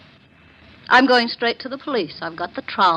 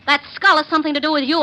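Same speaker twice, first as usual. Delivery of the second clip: louder and higher, fast and fierce; angry accusation; 7 semitones higher, 4 dB louder than usual. Acted. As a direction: throw the accusation in his face. Accusing him of lying.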